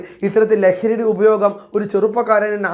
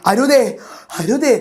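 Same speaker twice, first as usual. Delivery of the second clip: louder and higher, a rising plea of alarm; crying out loud; 4 semitones higher, slower than usual. Retold or imitated. Mother cries out to her son not to hurt himself, loud. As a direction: call out to stop him, alarmed. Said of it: "എന്നു ഞാൻ ഉറക്കെ നിലവിളിച്ചു പോയി".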